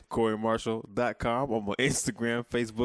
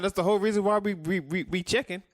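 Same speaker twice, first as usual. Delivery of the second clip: excited stammer; 9 semitones higher than usual. Real, not acted. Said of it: "How many times you can say we".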